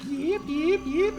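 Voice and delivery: high squeaky yip yip; yappy chihuahua voice. Retold or imitated. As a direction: yap like a tiny dog. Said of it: "sees the note of".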